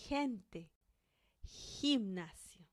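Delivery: Gente with a throaty h sound; Spanish throaty g. Shown as a demonstration. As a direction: Gimnasio said slowly with a throaty h. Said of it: "We have to pronounce them like this".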